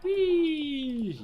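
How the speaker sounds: high, loud playful squeal; gleeful whee. Real, not acted. Player cheering at the game, high loud squeal.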